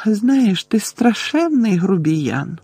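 high, slow, unbothered remark; unperturbed. A narrator's reading. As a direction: say it placidly, taking your time. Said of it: "незворушно сказала Луна". (незворушно, високо й повільно)

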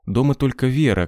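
usual speaking voice

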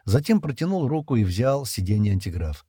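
ordinary delivery, nothing marked

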